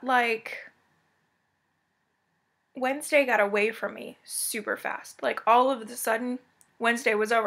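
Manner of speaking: ordinary talk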